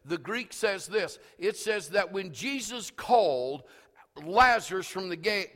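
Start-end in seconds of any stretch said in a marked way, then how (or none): none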